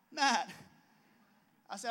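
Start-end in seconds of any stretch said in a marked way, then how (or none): 0.1-0.5 higher, louder relieved hello; a relieved, louder call of his name; eager greeting. Retold or imitated.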